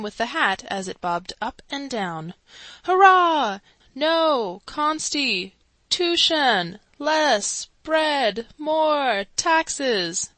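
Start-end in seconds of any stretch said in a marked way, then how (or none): 2.8-3.6 loud falling hurrah; crowd cheer. A narrator's reading. 3.9-10.3 higher chanted slogans, one per beat; higher mob chant; crowd shouting slogans. A narrator's reading.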